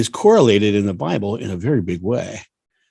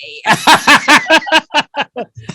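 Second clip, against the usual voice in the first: high laugh; 7 dB louder than usual. Real, not acted. Cracks up at the announcer bit.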